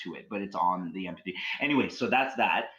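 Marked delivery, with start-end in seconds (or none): none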